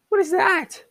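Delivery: high incredulous squeal at a silly name; squeaky disbelief. Real, not acted. Baffled at the new name.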